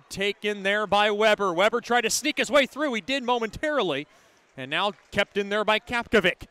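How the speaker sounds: ordinary talk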